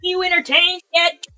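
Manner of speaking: loud arena taunt; barbarian. Pretend. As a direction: taunt the crowd loudly. Taunting the chat, arena style.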